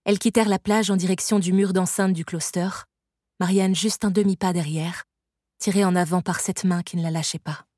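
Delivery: ordinary delivery, nothing marked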